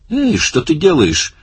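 high, drawn-out outraged cry; indignant protest. A narrator's reading. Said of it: "раздался возмущённый голос Слайди". (возмущённый возглас, высоко и протяжно)

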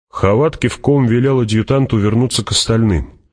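ordinary delivery

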